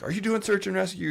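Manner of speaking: high-pitched, falling interrogation; challenging question. Retold or imitated. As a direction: imitate an incredulous challenger. Retold challenge from choir member.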